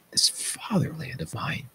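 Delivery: a high, breathless whisper. Acted. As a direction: let the curse sink into a whisper. Oedipus curses his own presence.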